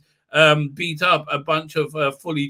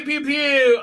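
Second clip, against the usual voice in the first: laser noises; 9 semitones higher than usual. Retold or imitated. Laser fire effects.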